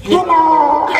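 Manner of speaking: chipmunk-high hello; high voice effect. Shown as a demonstration. Trying the high effect on the mic. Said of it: "May E F F F siya dito".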